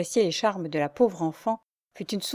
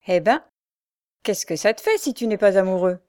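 mocking laugh; 5 dB louder than usual. A narrator's reading. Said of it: "Jacques éclata de rire".

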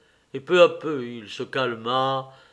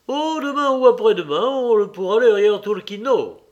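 high, loud steward voice; steward's offhand excuse; breezy, evasive steward; 7 semitones higher, 6 dB louder than usual. Acted. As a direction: put the boss off in a pitched-up voice. Voices the intendant in dialogue.

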